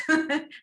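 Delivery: quick bright burst of laughter; short laugh. Real, not acted. Preacher laughing at the widow's shoe spree, bursting out. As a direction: laugh at the absurd story.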